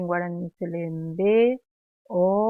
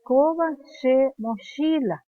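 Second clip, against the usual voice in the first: Koba raised and loud, then falling; model sentence; 5 semitones higher, 4 dB louder than usual. Shown as a demonstration. Teacher modelling the pupil's line, raised voice. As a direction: announce it clearly as the example line. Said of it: "Ella cuando señala va a decir".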